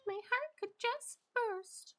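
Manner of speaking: very high, slow princess voice; high, dreamy storybook heroine impression. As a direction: coo it like a Disney princess.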